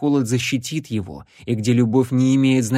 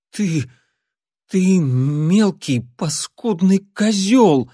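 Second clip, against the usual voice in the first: hoarse, forced out; 7 semitones higher, 4 dB louder, much slower than usual. Acted. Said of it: "хрипло выдавила Нола".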